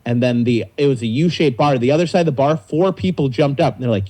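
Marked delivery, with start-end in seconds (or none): none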